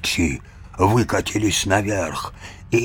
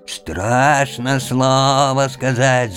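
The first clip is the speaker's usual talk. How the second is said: higher, slow bleat; bleating ram; 6 semitones higher, 3 dB louder, slower than usual. Acted. Ram's head echoing grandfather, slow bleat. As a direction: bleat it out slowly. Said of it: "заблеяла баранья голова".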